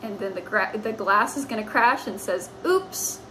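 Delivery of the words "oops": mock oops. Naughty cat.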